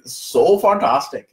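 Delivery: slow, drawn-out "So fantastic" in a put-on Indian accent. Host demoing his Indian accent to Sally. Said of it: "I did this to Sally. I'm like".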